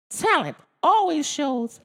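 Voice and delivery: high, odd voice of a smug alien. A narrator's reading. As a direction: play the cocky alien. Alf's voice in the story.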